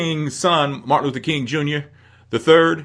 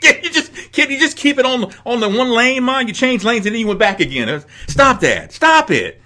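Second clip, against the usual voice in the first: mock nagging mother; 10 semitones higher than usual. Mocking mother's driving nags.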